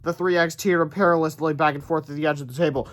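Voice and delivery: ordinary delivery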